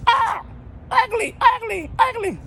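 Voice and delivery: very high, loud squawk, slow; squawking parrot. Retold or imitated. Parrot squawking at the lady, shrill and loud. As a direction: screech it like a parrot.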